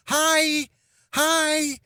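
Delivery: put-on high, cheery toddler greeting. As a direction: chirp hi like a little girl waving at a train. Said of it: "She's going".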